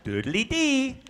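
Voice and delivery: "doodly-dee" sung out high and lifted at the end; "doodly-dee" as a bouncy rising speech rhythm; upbeat rhythm. Shown as a demonstration. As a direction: lilt "doodly-dee" upward, loud and positive. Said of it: "Americans always sound very positive".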